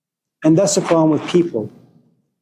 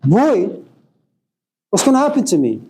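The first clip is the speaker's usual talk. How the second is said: lightly voiced worried chicken, pitched up; higher, anxious chicken reaction; alarmed chicken; 4 semitones higher than usual. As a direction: question the cook in mild mock alarm. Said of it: "the chicken looks at the cook and says".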